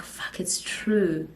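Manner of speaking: slow, hushed whisper to herself; whispered realisation. Retold or imitated. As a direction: whisper it slowly as the truth sinks in. Voicing her dawning realisation in silence.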